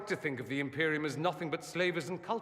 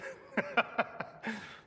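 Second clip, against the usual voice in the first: amused laugh; 10 semitones higher than usual. Acted. Amused by optimist remark.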